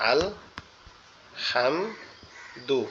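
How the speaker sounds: syllable by syllable, soft breathy হা in হাম; Arabic letter ha demonstrated; correct Arabic ha. Shown as a demonstration. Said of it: "ধরেন আমরা পড়তেছি আলহামদুলিল্লাহ".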